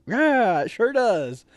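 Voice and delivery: high, loud smug reply; pleased cocky yes; smug agreement. Retold or imitated. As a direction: gloat cheerfully. Agreeing smugly.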